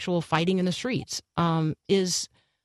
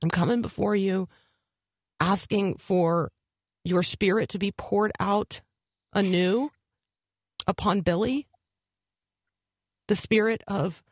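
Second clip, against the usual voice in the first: prayer. Acted. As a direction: pray aloud with long pauses. Pastor prays aloud on air.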